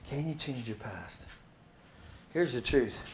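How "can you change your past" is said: Can you change your past said again much softer; soft repeat. Shown as a demonstration. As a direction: let the question hang quietly. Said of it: "let it sit for a minute".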